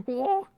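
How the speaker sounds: high, loud gnome "whoa"; mock gnome hoot; imitating the gnome sound. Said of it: "what the classic, uh, gnome sound".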